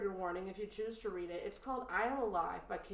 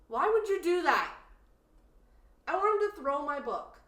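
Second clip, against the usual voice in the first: exasperated outburst; 6 semitones higher, 7 dB louder than usual. Real, not acted. Outraged at author killing dog.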